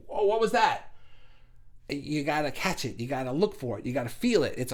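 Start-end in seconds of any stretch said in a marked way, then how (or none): 0.1-0.9 higher, mildly startled question; startled noticing. Acted. Catching a brief thought from source, mock startled. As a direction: pop up as if something flashed past. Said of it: "You'll be like".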